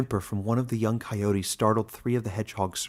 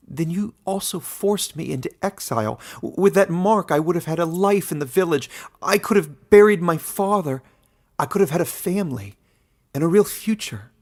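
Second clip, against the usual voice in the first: raised, anguished protest at the warden; hurt and loud, demanding back a lost life; Caleb, young man pleading; 8 semitones higher, 7 dB louder than usual. A narrator's reading.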